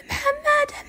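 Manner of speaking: very high scream of the name; piercing scream. Retold or imitated. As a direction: scream for him through the door. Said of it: "قمت أصارخ وأقول".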